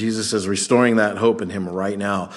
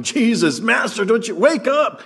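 high, frantic cry for help; fishermen's pitched-up panic in the storm; panicked shout; 10 semitones higher than usual. Acted. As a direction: yell to wake him in terror. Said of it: "They're shouting".

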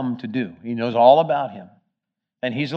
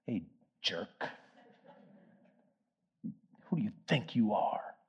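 mild mock contempt; 3 semitones lower, 13 dB quieter than usual. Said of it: "He could have said".

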